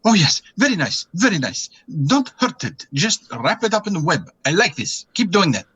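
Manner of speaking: high, pleased, coaxing voice; gleeful character egging them on; Surek, delighted. Acted. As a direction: purr your approval from above. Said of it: "You hear him saying".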